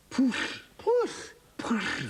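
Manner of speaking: Push, p plus oo; Welsh pw as push. Shown as a demonstration. Teacher building the Welsh word for Fred, saying Push. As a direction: Push said with a long oo. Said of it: "another letter in front".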